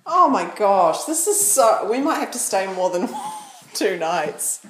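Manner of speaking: loud excited gushing; thrilled, voice up and loud; loud matey lad banter. Real, not acted. Excited about the nice house.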